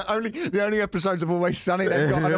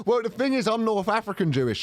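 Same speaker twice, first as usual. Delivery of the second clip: ordinary delivery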